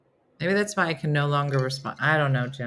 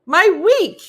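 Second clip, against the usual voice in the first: loud, very slow, rising on "week"; gushing thanks; 7 semitones higher, 8 dB louder, much slower than usual. Retold or imitated. Hank thanking the host after the call, overjoyed. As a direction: savour each word with delight. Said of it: "he was just like".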